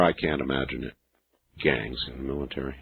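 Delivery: very soft and low, to oneself; hushed, low disbelief; low musing. Real, not acted. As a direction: drop right down, as if thinking aloud.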